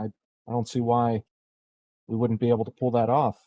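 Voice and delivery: normal talking voice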